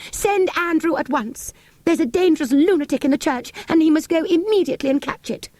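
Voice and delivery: high, urgent maid's orders; frightened servant giving orders; panicked maid. Acted. Maid sends for help.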